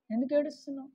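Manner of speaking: soft voice after the cry; hushed divine question. Acted. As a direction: drop suddenly to a quiet question. God gently asking the weeping one.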